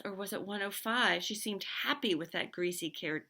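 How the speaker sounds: usual speaking voice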